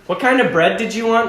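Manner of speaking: mild mock shout; retold shout. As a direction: imitate a clerk calling out to a customer. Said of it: "this guy shouts".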